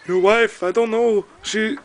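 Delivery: high, loud imitated Filipino accent; heavy Filipino accent. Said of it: "very heavy Filipino accent".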